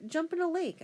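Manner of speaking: high-pitched, playful dismissal; mock brush-off. Father imagined brushing off someone in French, mock dismissive. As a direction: wave them away in a high voice.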